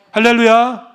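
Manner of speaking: ordinary talk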